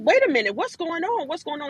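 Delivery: high, rising alarm at the insult; alarmed confusion. Retold or imitated. Retelling being snubbed, rising alarm. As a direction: caught off guard and protesting.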